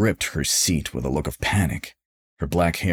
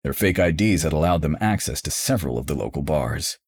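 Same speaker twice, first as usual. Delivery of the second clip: ordinary delivery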